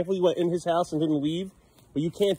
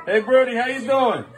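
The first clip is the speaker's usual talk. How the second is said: bright, loud, higher greeting to the dog; cheery call out; 10 semitones higher, 7 dB louder than usual. Real, not acted. Owner calling across the yard to Brody, cheerful.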